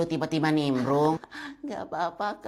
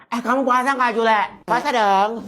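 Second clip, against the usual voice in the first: ordinary delivery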